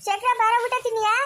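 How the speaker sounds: high-pitched summons to a meal; calling out. Acted. Calling a boy to come and eat, high call.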